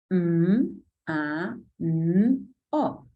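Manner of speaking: each letter sound said separately, M then A then N then O. Shown as a demonstration. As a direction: sound out M, A, N, O one by one with small gaps. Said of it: "segmentar la palabra fonéticamente".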